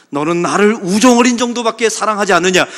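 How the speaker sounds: the Lord rebuking Peter loudly; stern rebuke. Acted. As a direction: scold like a disappointed master.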